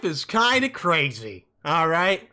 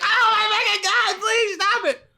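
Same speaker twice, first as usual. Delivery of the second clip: much higher, loud mock-horrified squeal; horrified onlookers; 4 dB louder than usual. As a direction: squeal it like a disgusted bystander. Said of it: "And everyone's like".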